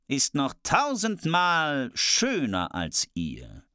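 very slow, solemn mirror voice; mirror's answer. Acted. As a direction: pronounce the verdict like a magic mirror.

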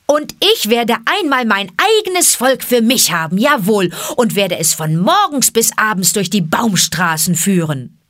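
loud, high boastful call. Acted. Boy shouts his boast to his two friends. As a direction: shout it at the top of his lungs. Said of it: "rief er aus vollem Halse".

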